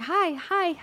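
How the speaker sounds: put-on high, bright kid hello; little boy greeting. Boy on a bike greeting the walker, high eager voice. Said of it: "he's like".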